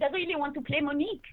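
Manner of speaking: put-on lilting French-accented English; Monique's French accent. Actress shows how she auditioned as Monique, French accent. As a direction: play the French exchange student, heavy on the accent. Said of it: "I went in and I said".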